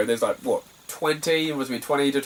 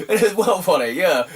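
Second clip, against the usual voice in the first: said through laughter, louder and higher; laughing; 8 semitones higher, 6 dB louder, slower than usual. Real, not acted. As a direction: laugh the words out. Laughing at own story.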